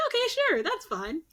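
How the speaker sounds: high, eager pushover agreeing; dim-witted victim voice. Retold or imitated. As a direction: squeak out a gullible yes. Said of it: "He's like".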